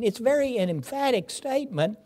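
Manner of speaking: ordinary talk, nothing marked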